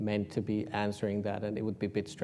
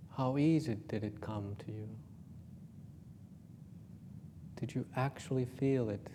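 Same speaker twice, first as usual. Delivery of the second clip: guided meditation; 8 dB quieter than usual. Acted.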